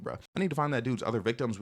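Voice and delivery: ordinary talk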